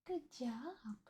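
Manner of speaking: very soft, high pet praise; cooed praise. Real, not acted. Streamer tells her cat good job, softly cooing. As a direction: praise a pet in a tiny hushed coo.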